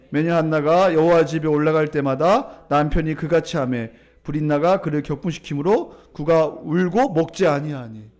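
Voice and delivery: low, loud, measured verse reading; scripture reading aloud. Real, not acted. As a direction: lead the congregation through the verse. Leads congregational scripture reading.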